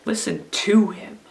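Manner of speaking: "to" punched out, "him" falling; stress on TO. Shown as a demonstration. Teacher showing children the second-word reading, stress on "to". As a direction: hit the small word "to". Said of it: "if we stress the second word".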